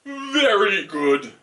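slow, loud, approving owl; wise hooting owl. Acted. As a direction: praise slowly as an owl. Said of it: "said the owl".

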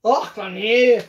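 put-on a rough, half-hearted Scottish accent; lightly mocking Scottish accent. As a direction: imitate a Scottish accent badly. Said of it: "they talk in Scottish, they go".

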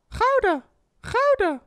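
very high falsetto exclamation; catchphrase squeal. Shown as a demonstration. Streamer testing his own VTuber sound, high falsetto. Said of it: "I need to have my own sound".

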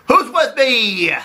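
high, slow rallying call; rallying cry. Acted. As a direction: rouse the troops. Leader rallying the roaches.

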